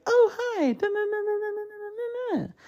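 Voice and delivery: squeaky-high, bubbly automated greeter; mock the chirpy pre-recorded drive-thru girl; perky recorded greeting. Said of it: "like, all perky".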